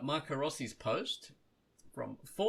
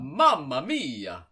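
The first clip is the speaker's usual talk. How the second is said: put-on Italian accent; 9 dB louder, slower than usual. Reading post as Marco, permission granted.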